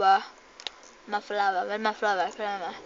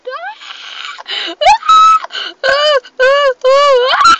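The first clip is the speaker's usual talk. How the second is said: repeated high, loud screams; panicked screams; 13 dB louder, slower than usual. Real, not acted. Player scared by the game, panicked screaming. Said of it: "I'm panicking!".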